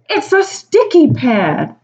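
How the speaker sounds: high, slow horrified voice; mock horror, drawn out; hammed-up appalled "sticky pad"; appalled colleague. Colleague appalled about trap.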